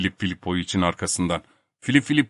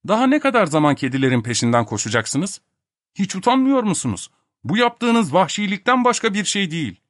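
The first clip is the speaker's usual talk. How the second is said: high-pitched, indignant reproach; scolding; 10 semitones higher than usual. A narrator's reading. Bormental telling Topak off over chasing cats, scolding. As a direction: shame him for his savagery.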